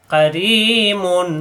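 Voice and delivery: কারিমুন with রি held long, slow recitation; stretched vowel. Shown as a demonstration. Teacher stretching কারিমুন slowly for students. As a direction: draw out the রি in কারিমুন. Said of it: "রিকে লম্বা করতে হবে".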